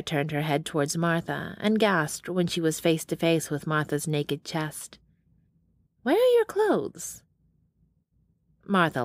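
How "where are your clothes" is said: shocked. Acted. Groggy Sarah shocked by nakedness.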